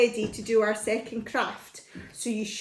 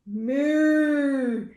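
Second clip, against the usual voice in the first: long drawn-out moo, a little louder; cow mooing; 4 semitones higher, 4 dB louder than usual. Acted. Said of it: "The cow said".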